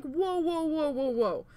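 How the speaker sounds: high, loud string of whoas; halting protest. Retold or imitated. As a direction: cut off someone's tirade. Retold halting of screaming manager.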